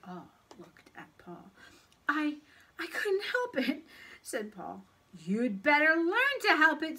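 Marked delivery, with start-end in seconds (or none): 2.1-3.8 high, halting protest; guilty, higher-pitched child; sheepish excuse. A narrator's reading. Paul caught, making his excuse. 5.2-7.0 loud, higher stern reprimand; cross schoolteacher voice; scolding teacher. A narrator's reading.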